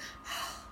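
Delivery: soft, heavy exhale; weary sigh. Acted. Reader voicing burdened Aquarian, weary sigh. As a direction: let out a reluctant sigh. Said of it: "I'm getting this, like".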